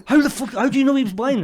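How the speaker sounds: high, disbelieving demand; can't believe it, pushing back; incredulous. Retold or imitated. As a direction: comedian disbelieving that he missed the blind man. Incredulous at being told.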